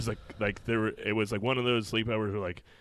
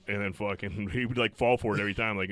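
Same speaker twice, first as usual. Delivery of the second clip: ordinary delivery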